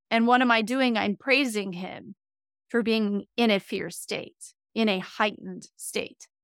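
ordinary talk

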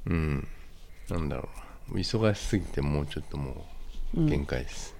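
stuffy nasal voice from a cold. Real, not acted. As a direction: talk through a blocked nose. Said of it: "鼻声だしね".

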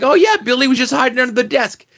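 high, fast, breezy dismissive voice; hammed-up carefree teacher; breezy mock teacher. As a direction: toss it off fast and high like nothing happened. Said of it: "it's just like".

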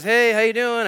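imitated cop. Officer greeting the driver, imitated cheery cop. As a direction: play the breezy motorcycle cop.

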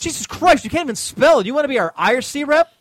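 loud, high and fed up; exasperated. Real, not acted. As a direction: throw up your hands at him. Exasperated at misspelling.

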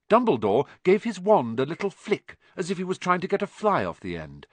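ordinary delivery

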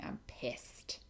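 whispered anger. Real, not acted. Whispering her anger at the vet to listeners. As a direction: whisper it, seething quietly. Angry aside about the vet.

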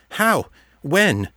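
surprised. A narrator's reading. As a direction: caught off guard, asking fast. Kate startled by news.

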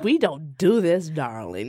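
mock-prissy, slow and dropping low on "darling". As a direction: lightly imitate a prim, drawn-out reproof. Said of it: "She used to get real prissy".

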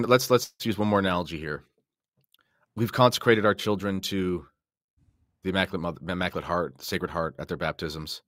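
ordinary talk

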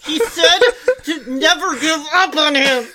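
hammed-up sobbing plea; melodramatic crying. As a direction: wail it like a soap opera. Mock dramatic soap opera plea.

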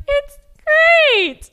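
high, loud, drawn-out mimicry; mocking imitation of co-host. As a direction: tease her with an exaggerated high-pitched echo. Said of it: "Do you see how she's like".

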